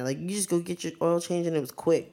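normal talking voice

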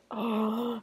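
mock shocked gasp; sharp, hammed-up intake of breath; dramatic gasp. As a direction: gasp like a soap-opera reveal. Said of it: "her mom's face is just like".

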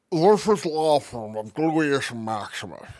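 slow, put-on fat lawyer. Crank caller posing as a law firm, slow mock lawyer voice. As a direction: prank call as a ponderous lawyer. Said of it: "maybe I can crank call people with that".

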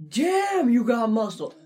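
high, loud, leering impression; creepy admiring coworker. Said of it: "he's like".

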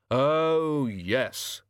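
dry, sarcastic Oh, yes; drawn-out disbelieving sarcasm. A narrator's reading. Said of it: "with what almost sounded like sarcasm".

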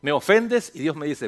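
normal talking voice

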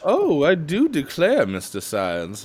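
high, slow Southern belle drawl; Southern belle accent. Retold or imitated. Imitating a Southern belle in a slow high Southern drawl. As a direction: coo it like a flirty Southern belle. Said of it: "you get me a Southern belle".

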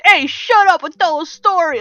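loud, high Brooklyn puppet voice; brash cartoon Brooklyn shout; Brooklyn accent. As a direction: yell it like a pushy Brooklynite. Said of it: "The beetle was like from Brooklyn".

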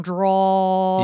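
"drawl" dragged out long; Southern drawl. Shown as a demonstration. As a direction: stretch "drawl" to mimic a Southern drawl. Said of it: "they've got that".

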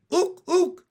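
very high, loud froggy hoots; two piercing, excited hoots; gleeful shout. A narrator's reading. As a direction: whoop it like a delighted frog. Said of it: "shouted Pin".

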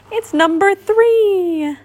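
high, drawn-out delighted cry; happy cry. A narrator's reading. Pooh recognising his honey pot, crying happily. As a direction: stretch it out joyfully, way up high. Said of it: "Pooh cried happily".

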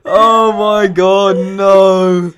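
loud, high cry breaking into laughter; appalled protest. Real, not acted. Co-host appalled at the accent, loud laughing protest. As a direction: wail it in laughing horror, then slow down.

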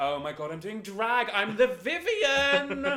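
high, loud, slow camp diva voice; gushing drag queen client. Retold or imitated. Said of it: "she was going like".

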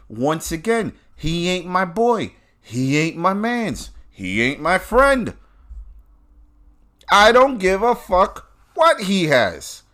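high, drawn-out emphatic denial; fed-up, slow and insistent; exasperated disavowal. Real, not acted. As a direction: push each refusal up high, then let it fall. Exasperated denial of any friendship.

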